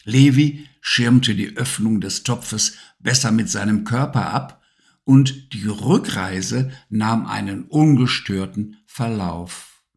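ordinary delivery

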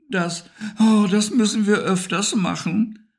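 high, out of breath after dancing; panting. Acted. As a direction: gasp it out, happily spent. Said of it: "keuchte Harry". (hoch, keuchend, außer Atem)

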